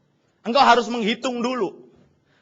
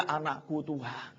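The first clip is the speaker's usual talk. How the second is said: ratapan lirih dan lambat; pura-pura menangis; 6 semitones lower, 11 dB quieter, slower than usual. Acted. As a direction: meniru ayah meratap pelan kepada Tuhan. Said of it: "dia nangis sama Tuhan. Dia teriak". (menangis lirih dibuat-buat)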